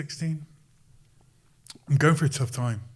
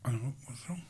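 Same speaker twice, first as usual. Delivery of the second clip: mock whisper of awed kids; very soft, voiceless aside in a retelling; imitated hushed whisper; 11 dB quieter, faster than usual. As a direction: whisper it under your breath, imitating onlookers. Retells kids' hushed reaction to a star.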